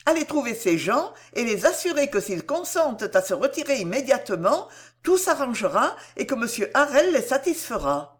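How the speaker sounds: urgent and reassuring, voice lifted high; earnest heroine, urgent request. Acted. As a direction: send him off to settle matters. Narrator voices Cécile requesting.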